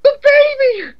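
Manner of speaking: high, shrieking, hammed-up panic; hysterical damsel. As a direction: ham up a frantic helpless heroine. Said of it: "Then it's like".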